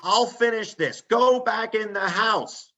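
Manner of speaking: high, slow yell to grandpa; mock yell at stubborn grandpa; yelling over snowblower. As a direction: shout it over the engine noise. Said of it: "I'm yelling at him".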